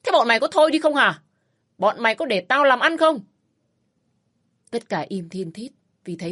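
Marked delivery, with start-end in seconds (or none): 0.0-3.2 loud furious outburst at the squabbling family; angry shout. A narrator's reading. Father-in-law yells at the bickering women, furious. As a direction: snap at them, loud and fast. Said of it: "Bố chồng tôi rít lên".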